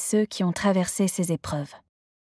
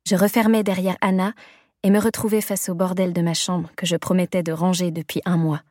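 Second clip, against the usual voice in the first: ordinary delivery, nothing marked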